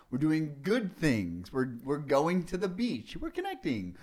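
sing-song high rising lilt on each item; playfully idealized happy-times list. Acted. Listing happy times with the kid.

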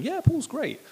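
high-pitched, enthusiastic vouch; glowing endorsement. Acted. Church recommending Paul to another church, glowing endorsement.

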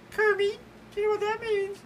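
very high, diva pig voice; high, haughty puppet diva; Miss Piggy. Acted. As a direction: play the pampered pig star. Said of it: "Miss Piggy".

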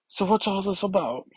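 high, odd put-on girl voice; a badly done teen voice; horrible voice. X twenty-three asks her question in a bad put-on voice. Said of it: "Oof, that's a horrible voice".

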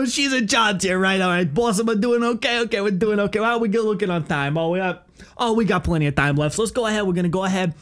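high, chattering caricature of an instructor; instructor impression. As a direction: check in with the supposed boss in a high put-on voice. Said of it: "he would, like, talk to them one-on-one".

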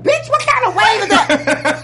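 high, loud comic outrage; alarmed outcry. Retold or imitated. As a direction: shout it in disbelief at the wave. Acted outcry at giant wave.

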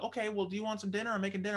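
high, light imitated wife voice; friendly invitation. Wife offers the guest dinner, friendly invitation. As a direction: pitch it up for a sociable offer.

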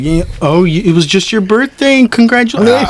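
high, rising congratulatory voice; imitated store cashier. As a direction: chirp it up like a cashier. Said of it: "She's like".

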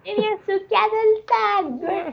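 put-on high tattling child; whiny child voice. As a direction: tattle to the teacher. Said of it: "Tipo, "Maestra".

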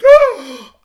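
a loud, high, winded gasp for air. Retold or imitated. Winded on the mat, loud high gasp. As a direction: gasp like the wind got knocked out of you.

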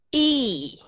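long English "y" held out clearly; teacher demonstrating the long ee vowel; long ee demo. Shown as a demonstration. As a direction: model a clean, drawn-out "y" sound. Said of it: "Hà Cát nói là".

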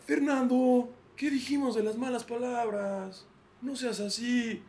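high, slow, limp scolding of a worn-out father; weary dad meekly scolding his son; tired dad. Acted. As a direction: scold without any force, like a beaten dad. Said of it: "papá cansado".

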